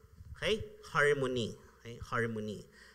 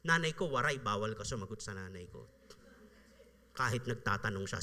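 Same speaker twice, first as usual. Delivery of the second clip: usual speaking voice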